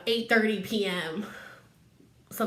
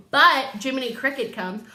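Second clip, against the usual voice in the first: usual speaking voice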